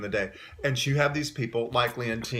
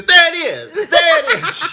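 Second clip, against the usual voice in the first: high, loud, laughing cries of recognition; excited squeal; 12 dB louder, slower than usual. Retold or imitated. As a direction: squeal it out with delight. Imitating singer spotting photo.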